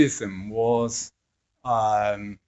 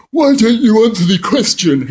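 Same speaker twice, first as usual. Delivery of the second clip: high, loud, demanding challenge; confrontational retort; 11 semitones higher, 12 dB louder than usual. Retold or imitated. NHS group member snapping back at the therapist, high and loud. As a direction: fire the question back in indignation. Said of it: "and they say".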